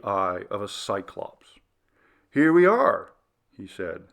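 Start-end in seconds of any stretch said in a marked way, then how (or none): 2.3-3.1 higher, louder, bright arrival; cheerful announcement. A narrator's reading. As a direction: sing it out brightly.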